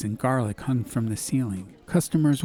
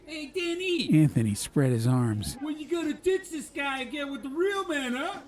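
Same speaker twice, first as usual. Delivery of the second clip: high, softer, teasing flirt; cocky teasing brother; 4 dB quieter than usual. Acted. Anthony flirting with Danny to needle his brother, high teasing. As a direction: rib his brother with a grin. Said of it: "piped up".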